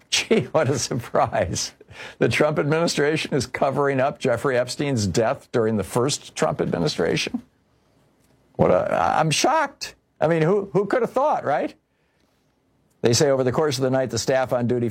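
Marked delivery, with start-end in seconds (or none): 0.1-7.4 higher-pitched sarcastic astonishment; mock surprise. Sarcastic reaction to cover-up news. 8.6-11.7 high-pitched, hammed-up disbelief; sarcastic shock. Feigned shock at predictable news.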